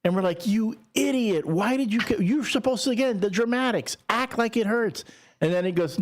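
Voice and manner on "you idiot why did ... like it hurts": high-pitched mock exasperation; exasperated scolding. Siblings to brother who spoiled the act, exasperated scolding. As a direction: scold in disbelief, pitched up and hammed up.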